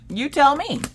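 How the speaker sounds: high-pitched, sassy comeback; mock-innocent high retort; pointed retort. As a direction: toss it back high and pointed. Retold retort to puzzled husband.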